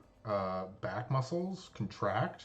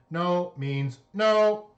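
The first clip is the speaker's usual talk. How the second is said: louder, higher, slow robotic warning; firm robot command; 8 semitones higher, 8 dB louder, slower than usual. Acted. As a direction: lay down the law, slow and firm. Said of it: "her robotic voice".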